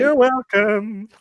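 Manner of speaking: slow, high and sweet; high cutesy voice. Pretend. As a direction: coo it like talking to a pet. Answering in Luna-style voice.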